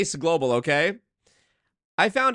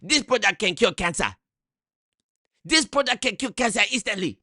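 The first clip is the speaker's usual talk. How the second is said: hammed-up Nigerian pitchman, building higher; mock Nigerian accent; 6 semitones higher than usual. Mocking the scam product claims, rising Nigerian accent. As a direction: oversell the miracle cure in Nigerian accent. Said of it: "in the Nigerian voice the whole time".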